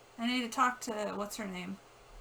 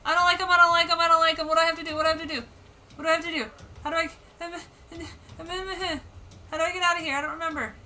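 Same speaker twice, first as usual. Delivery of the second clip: high, loud frightened babbling; real panic, voice pitched up; panicked; 6 semitones higher, 13 dB louder, much faster than usual. Real, not acted. Panicking at two monsters.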